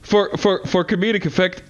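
normal talking voice

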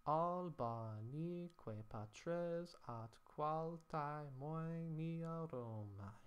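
slow, drawn-out chanting of the Latin line; slow measured Latin reading; Latin verse recitation. Shown as a demonstration. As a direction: intone it like a classroom scansion demo. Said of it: "our scansion come out looking like".

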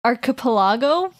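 archipelago as ar-kuh-puh-LAH-go, rising; tentative pronunciation. Shown as a demonstration. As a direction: test archipelago with a short "kuh". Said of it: "Is that how you say".

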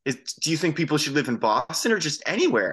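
mildly worked-up challenge, pitch climbing; retold indignant complaint. Upset bar-goer confronting the band member over a video, mock indignant. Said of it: "was all bent out of shape about this".

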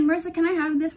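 pestering little sister. Retold or imitated. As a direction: beg for it like a kid sister. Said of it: "She's like".

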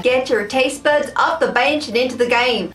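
badly faked American accent. Shown as a demonstration. Taste tester reads the chip bag aloud in a bad American accent. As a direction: have a clumsy go at an American accent. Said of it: "saying that in an American accent".

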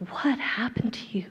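a hushed, teasing question; mock whispered surprise. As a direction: whisper the first part, then rise on vegetarian. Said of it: "And I'm like".

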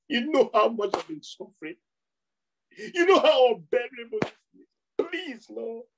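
high, tearful, begging prayer voice; mock crying plea. Preacher imitating a believer begging God, tearful. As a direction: whimper and beg like someone desperate. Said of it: "all crying, beggarly, pleading".

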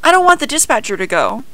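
high, quick, loud plea; upset cry. A narrator's reading. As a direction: cry out the protest in a rush. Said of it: "he cried".

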